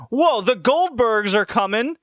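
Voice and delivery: mocking Dan. Retelling the fiance's selfish phone call, high mock whine. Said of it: "he goes".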